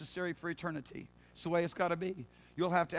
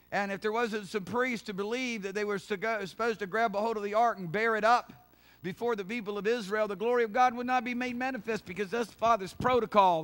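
raised, driving pulpit delivery; forceful preaching; 4 semitones higher, 5 dB louder than usual. Real, not acted.

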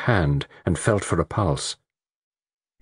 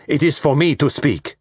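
raised, insistent assertion; crying out; 7 semitones higher, 3 dB louder than usual. A narrator's reading. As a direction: claim the floor firmly. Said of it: "cried Poirot".

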